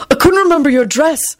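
high-pitched hasty excuse; hasty answer. A narrator's reading. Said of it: "he answered quickly".

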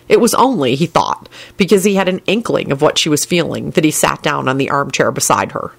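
ordinary delivery, nothing marked

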